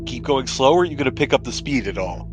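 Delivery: ordinary talk, nothing marked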